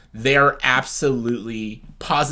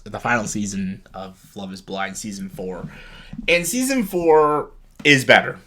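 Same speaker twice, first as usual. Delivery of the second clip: ordinary talk, nothing marked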